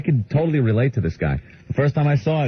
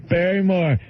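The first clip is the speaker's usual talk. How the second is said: slow, higher simple-man voice; Bill; 5 semitones higher, slower than usual. Retold or imitated. Bill insisting on too many numbers, slow high caricature. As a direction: keep stretching out muddled numbers. Bill phoning the operator again.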